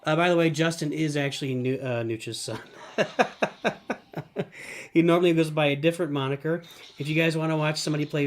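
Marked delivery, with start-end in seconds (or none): 2.6-4.9 long staccato chuckle; real laugh. Real, not acted. Streamer amused while introducing a friend's son, laughing. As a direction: burst into a run of breathy laughs.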